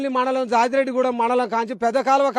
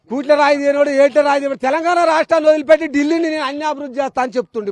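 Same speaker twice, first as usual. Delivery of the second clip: loud, higher and fast, spitting out the scorn; furious rant; 5 semitones higher, 6 dB louder, faster than usual. Real, not acted. Farmer denouncing the chief minister to the reporter, in a loud furious rant. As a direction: rail at him with rising fury.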